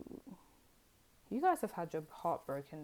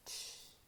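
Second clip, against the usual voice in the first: shush; 12 dB quieter than usual. Shown as a demonstration. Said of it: "You went".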